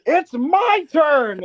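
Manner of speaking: slow mock shout of it's my turn; triumphant yell. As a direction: stretch it out like a victory call. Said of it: "You just hear".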